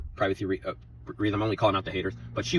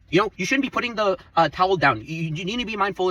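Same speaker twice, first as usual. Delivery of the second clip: high-pitched, nagging Karen impression; mock the preachy coworker with a raised, whiny pitch; 8 semitones higher than usual. Complaining about a coworker, imitating her as a high nagging Karen. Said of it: "comes storming in like she's fucking the queen of Karens".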